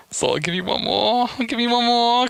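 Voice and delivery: teasing sing-song. Real, not acted. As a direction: dangle it in a high-pitched lilt.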